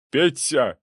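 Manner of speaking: high urgent call; desperate shout. A narrator's reading. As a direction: yell out to him sharply. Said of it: "крикнул он".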